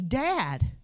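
imitated protest at Dad; mildly exasperated, acted-out reproach; exasperated daughter. Daughter protests her dad taking the blame, imitated exasperation.

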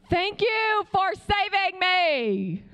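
very slow, high, forced gratitude; grudging thanks. Retold or imitated. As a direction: drag out each word through gritted teeth. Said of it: "That's how I felt at first".

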